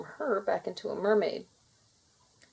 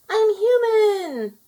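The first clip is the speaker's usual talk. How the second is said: much higher and louder, slow and drawn out; joyful cry; 14 semitones higher, 10 dB louder, much slower than usual. A narrator's reading. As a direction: shout it out in delight. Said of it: "Laurel cried".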